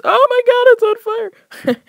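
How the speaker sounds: high, loud panicked cry; shrieking panic. Retold or imitated. Stomach burning in the bathroom, high panicked cry. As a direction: yelp it out in panic.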